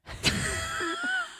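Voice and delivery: real giggling squeal; high squealing laugh. Real, not acted. Laughs after punchline.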